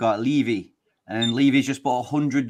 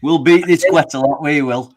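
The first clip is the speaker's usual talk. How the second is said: louder, hammed-up Yorkshire accent; jokey Yorkshire bluster; playful Yorkshire football boast; mock Yorkshire; 3 semitones higher, 7 dB louder than usual. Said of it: "Over in Yorkshire".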